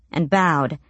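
ordinary delivery, nothing marked

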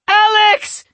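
high, loud frantic scream. A narrator's reading. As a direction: shriek his name in alarm. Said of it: "Yasmin screamed".

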